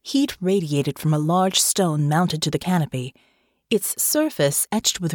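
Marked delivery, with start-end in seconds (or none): none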